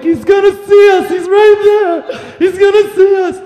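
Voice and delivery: high, frantic terrified whisper-yell; panicked squeal. Retold or imitated. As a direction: squeak it out like a kid hiding from a killer. Panicked under car from spotlight.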